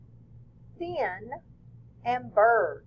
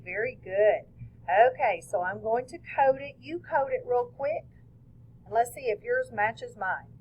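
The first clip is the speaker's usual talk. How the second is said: ordinary talk, nothing marked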